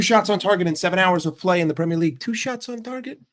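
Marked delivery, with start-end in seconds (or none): 2.2-3.2 high, disbelieving question; incredulous. Real, not acted. Fan questioning striker's two shots in seven hours, incredulous. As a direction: repeat it softer and much higher, in disbelief. Incredulous repeat of a poor statistic.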